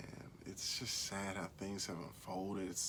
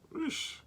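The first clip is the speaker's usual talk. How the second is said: whoosh sound effect; 4 dB louder than usual. Retold or imitated. Imitating the kangaroo bouncing.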